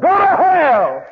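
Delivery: high, loud, slow yell of scorn; contemptuous holler. Retold or imitated. Passing driver yells at the warning man, loud and slow. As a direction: bellow it out the car window. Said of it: "rolled down his glass and hollered".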